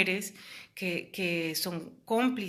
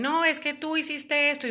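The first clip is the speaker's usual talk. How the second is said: higher and louder mock accusation; accusing abuser; 8 semitones higher, 5 dB louder than usual. Abuser blaming his partner, higher mock accusation. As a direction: throw the blame like an abuser. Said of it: "te dicen".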